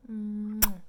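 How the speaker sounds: loud smacking air kiss; kiss sound. Real, not acted. Blowing viewers a kiss, loud smack.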